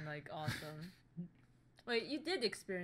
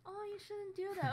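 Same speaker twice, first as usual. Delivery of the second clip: mock gentle scolding; 11 semitones higher than usual. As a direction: coo it like someone too nice to scold. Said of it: "I'm, like, the tough love type".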